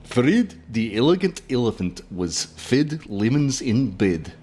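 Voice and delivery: slow Russian accent tongue twister. Shown as a demonstration. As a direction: drill the line in a Russian accent. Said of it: "So the tongue twister is".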